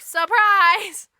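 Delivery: hammed-up ta-da; mock surprise reveal. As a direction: burst out with a big reveal. Wife reveals the living children.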